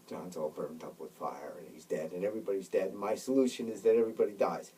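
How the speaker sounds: ordinary delivery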